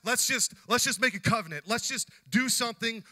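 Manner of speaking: loud, high, exasperated outburst; tense, fed-up Laban. Acted. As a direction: act out giving up on a bitter quarrel. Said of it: "feel the tension that's going on".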